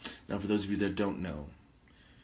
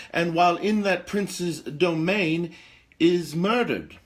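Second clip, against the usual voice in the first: higher, louder and slow, building to the blow; dramatic reveal; 7 semitones higher, 7 dB louder than usual. Acted. Host to her audience, revealing the murder dramatically. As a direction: land the murder like a scandal.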